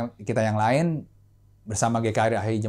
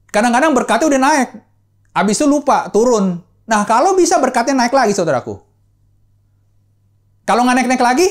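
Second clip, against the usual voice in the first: loud, high, fervent sermon voice; preaching at full force; 8 semitones higher, 8 dB louder than usual. Acted. Pastor exhorting the congregation, preaching loud and high. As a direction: drive the point home to the congregation. Preaching about fading blessings.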